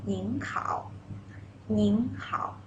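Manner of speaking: "Ning hao" said slowly, rising, for the class to repeat; model greeting. Shown as a demonstration. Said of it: "Please read after me".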